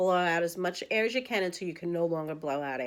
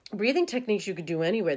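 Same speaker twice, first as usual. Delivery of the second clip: normal talking voice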